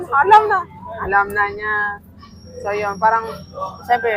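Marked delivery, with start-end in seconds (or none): 0.0-0.6 high, loud knowing exclaim; excited exclaim. Real, not acted. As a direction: blurt it out, thrilled you knew. Excited reveal. (masigla at malakas na "Alam na")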